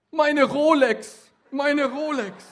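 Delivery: high, loud, slow cry of horror; horrified scream. A narrator's reading. Injured man screaming in horror to the policeman. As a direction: shriek it in sudden shock. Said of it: "Entsetzt schrie er auf".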